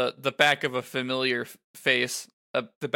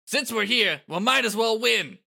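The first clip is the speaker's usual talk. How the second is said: captain's pep talk, pitched up; rallying the team; 9 semitones higher, 3 dB louder than usual. Acted. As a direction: rouse your teammates, voice rising high.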